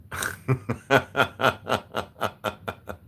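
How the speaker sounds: drawn-out staccato guffaw; long hearty laugh. Real, not acted. Streamer laughing at his own crude joke, long cackle. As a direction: keep the laugh going in even bursts.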